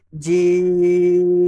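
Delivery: جیم held very long and slow; long jeem. Shown as a demonstration. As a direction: stretch جیم out slowly. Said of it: "اس کو تھوڑا سا لمبا کریں گے".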